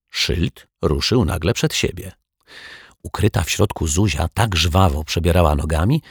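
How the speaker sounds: usual speaking voice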